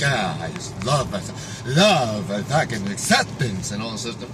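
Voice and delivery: mock a blissed-out guru, softly; mocking dreamy voice. Mocking self-love slogans.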